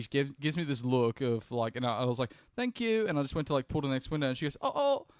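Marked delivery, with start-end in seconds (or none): 2.6-3.1 high bright thank you; mock cheerful thanks; retold chirpy thanks. Retold thanks at drive-through. 4.6-5.1 high, urgent mock call of a teen cashier; high girly cashier voice calling after him; imitating the cashier girl.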